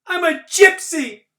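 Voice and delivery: put-on very high, loud defiant boy's shout; boy shouting back at the girl, very high and loud; screaming back. A narrator's reading. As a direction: scream back at her in outrage. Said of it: "I screamed back at her".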